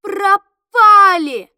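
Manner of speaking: long drawn-out loud wail; slow, loud shout of doom; despairing cry. A narrator's reading. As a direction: cry out that all is lost, slowly. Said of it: "А кто-то крикнул".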